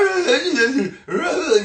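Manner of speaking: high, loud imitated cackle; mock laughter. Goofy friend group laughing at someone, mocking cackle. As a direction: overblown laughing at nothing. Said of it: "They'll be like".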